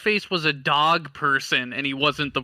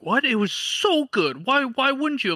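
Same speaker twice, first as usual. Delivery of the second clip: high-pitched, baffled protest, drawing out "so good"; mock incredulous; 9 semitones higher than usual. Fans baffled that he refuses to watch, mock incredulous. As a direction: imitate a fan who can't believe it.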